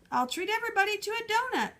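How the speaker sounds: high, fast call; yelled offer. Acted. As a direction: shout the bribe to the kids. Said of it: "yelled Uncle Ulysses".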